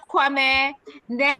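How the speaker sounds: ordinary talk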